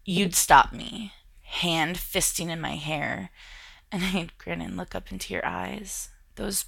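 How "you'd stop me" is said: plain reading voice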